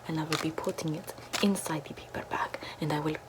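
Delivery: ordinary talk, nothing marked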